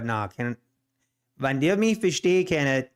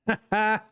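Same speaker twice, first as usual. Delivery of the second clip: brief, higher-pitched chuckle; short laugh; 6 semitones higher than usual. Real, not acted. Amused while showing off Dutch, short laugh. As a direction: let out a quick little laugh. Laughs amid language demo.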